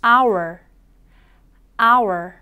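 "hour" said as ah plus our, a curled R at the end; 따라 하도록 보여주는 hour; model hour. Shown as a demonstration. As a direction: model "hour" twice for the class. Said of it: "함께 연습해 볼게요".

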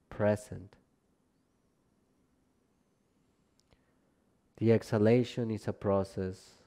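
calm, measured relaxation guidance, phrase by phrase; guided meditation. Acted. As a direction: guide them gently down into rest, unhurried and spacious. Leads a yoga nidra practice.

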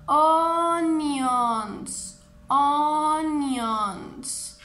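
"onions" with a long drawn-out first vowel; repeat-after-me model. Shown as a demonstration. Teacher leading children to repeat together, "onions" drawn out. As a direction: stretch "onions" out for learners to join in. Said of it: "todos juntos repitan conmigo".

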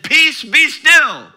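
slow, loud, high command to the storm; commanding Jesus. Acted. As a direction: speak to the storm with authority, slowly. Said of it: "spoke to the storm and said".

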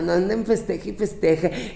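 normal talking voice

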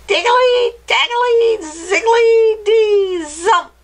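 very high, loud screechy incantation; screechy witch. Acted. Witch casting a spell on a dog, high screechy voice. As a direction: shriek out the magic words as a witch. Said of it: "in her high, screechy voice".

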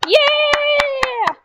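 high, loud drawn-out yell; triumphant gamer shout; excited cheer. Real, not acted. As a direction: cheer at the top of your voice. Cheering at game countdown's end.

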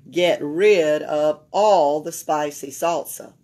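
usual speaking voice

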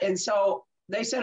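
ordinary delivery

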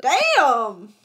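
surprised. Real, not acted. Reacting to price.

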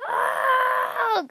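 high, loud hammed-up shriek; mock terrified scream. Gamer fleeing the evil Mario balls, hammed-up scream. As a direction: scream like a horror victim.

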